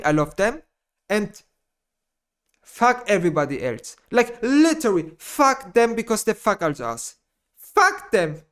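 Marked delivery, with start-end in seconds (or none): none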